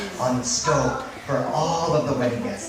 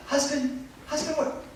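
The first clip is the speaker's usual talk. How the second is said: high, softish searching call; puzzled wife, pitched up high; calling for husband; 10 semitones higher, 6 dB quieter than usual. Acted. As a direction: call out lightly and high for someone missing. Wife calls for missing husband.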